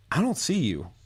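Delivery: high put-on voice of a lost buddy; teasing high-voiced imitation; mock clueless friend. Retold lost friend an hour later.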